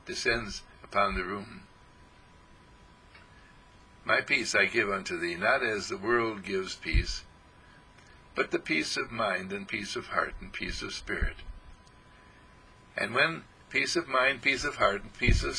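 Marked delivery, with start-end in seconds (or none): 4.0-11.4 solemn quoted scripture; scripture recitation. Acted. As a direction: intone the verse gently. Quoting scripture, slow and low.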